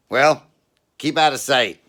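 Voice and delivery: high, loud put-on cop voice; a suspicious cop barking a question; gruff cop. Cop questioning a wet man in bushes, loud high voice.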